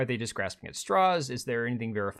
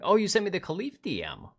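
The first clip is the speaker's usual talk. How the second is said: usual speaking voice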